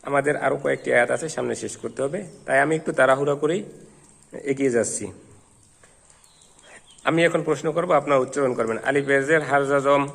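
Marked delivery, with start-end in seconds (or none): none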